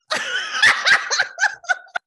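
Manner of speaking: a real laugh, loud and high. Real, not acted. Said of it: "I immediately started laughing".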